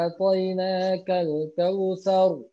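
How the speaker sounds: kautsar drawn out slowly, heavy final r; thick ro. Shown as a demonstration.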